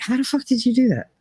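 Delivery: mock his baffled outrage, rushed; a hint of the sore loser ex; mocking ex-husband.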